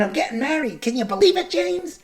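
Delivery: high, dumb-sounding put-on voice; weird CIA guy voice. CIA guy sharing wedding news with James, weird high voice. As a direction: go high and fake, like the dumbass agent. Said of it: "he has that weird voice".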